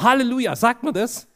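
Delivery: excited. Retold or imitated. Excited passenger after good news.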